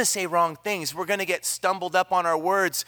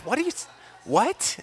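confused what; 6 semitones higher than usual. Retold or imitated. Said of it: "I'm like".

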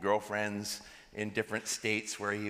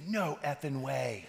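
drawn-out, disbelieving exclamation; mock disbelief; 2 semitones higher, slower than usual. Ride driver learns his passenger is a pastor, astonished. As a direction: stretch out each word in amazement.

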